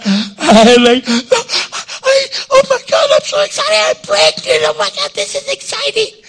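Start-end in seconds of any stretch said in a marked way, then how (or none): 1.1-6.2 squealing much higher, thrilled; giddy excitement. Retold or imitated. Old Sarah learning she is pregnant, squealing with joy. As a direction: gush like a giddy girl with news. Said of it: "I think she was like".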